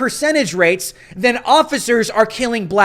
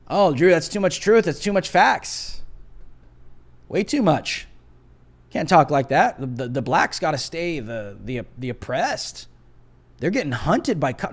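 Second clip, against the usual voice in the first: mocking imitation of critics, softer and lower; 7 semitones lower, 6 dB quieter than usual. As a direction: sneer their words back in a whiny lower voice. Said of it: "I can't talk like this, though, right?".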